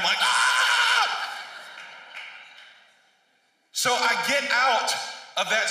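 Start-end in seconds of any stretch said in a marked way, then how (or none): 0.2-1.4 very high, drawn-out mock scream. Listener the preacher points right at, high mock scream. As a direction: squeal as if singled out by the preacher. Acts out his stunned reaction.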